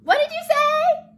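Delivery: very high, loud cricket voice; high-pitched, shouted question; oblivious cricket. A narrator's reading. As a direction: squeal it loudly like a little cricket. Voicing the cricket.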